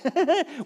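high nervous chuckle; nervous laugh. Retold or imitated. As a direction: laugh off the panic. Nervous moment on the hill.

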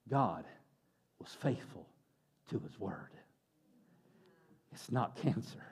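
hushed, halting, moved to tears; low and very soft, overcome, with long pauses; imitating tearful relief. Husband tells his wife the good news through tears, imitated choked-up hush. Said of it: "And he's crying".

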